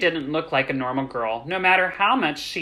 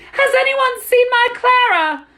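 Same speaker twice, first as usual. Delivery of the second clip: very high, loud panicked plea; frantic cry; 8 dB louder than usual. A narrator's reading. Mother searches crowd for Clara, frantic cry. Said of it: "Missus Brahms cried frantically".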